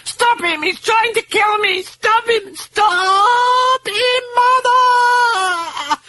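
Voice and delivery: very high shrieking cries for help; terrified screams. Acted. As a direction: scream as if someone is killing you. Possessed girl screams for help.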